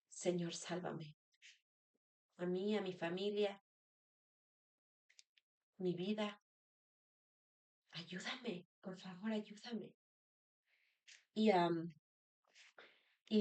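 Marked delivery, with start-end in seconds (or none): 0.1-9.9 pleading prayer. Retold or imitated. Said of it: "damos voces y decimos".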